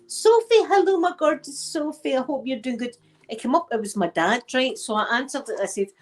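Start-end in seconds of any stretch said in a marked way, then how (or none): 0.1-3.0 high, delighted phone greeting; a hint of an excited dad on the phone; dad's cheery voice.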